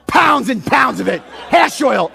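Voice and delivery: retold sarcastic confession, punched out; livid. Customer exaggerating drug use to the agent, livid. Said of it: "and I'm livid, right?".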